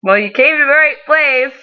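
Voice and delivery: cheesy sales pitch. Retold or imitated. Bakery worker to cheesecake customer, exaggeratedly cheesy. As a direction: oversell it like a cheesy shop worker. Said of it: "not that cheesy".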